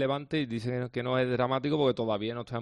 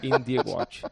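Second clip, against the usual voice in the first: laughing; 9 semitones higher, much slower than usual. Real, not acted. Laughing about his alarm jingle.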